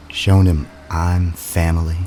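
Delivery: plain reading voice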